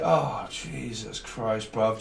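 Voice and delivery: slow, soft, drawn-out groan of annoyance; fed up at being called again; weary exasperation. Retold or imitated. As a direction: sag under yet another interruption. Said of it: "And you're like".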